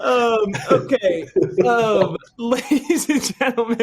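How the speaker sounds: host laughing as he restarts the show; laughing through the intro. Real, not acted. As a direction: giggle while resuming hosting. Laughing, composing himself to host.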